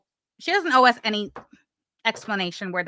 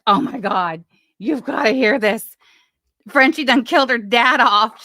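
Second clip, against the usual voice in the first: louder, eager retold call to someone in the kitchen; excited call; 6 dB louder than usual. Vlogger recalls calling Yaba over to hear the news, louder and excited. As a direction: call out like you can't wait to share gossip. Retelling calling to her partner.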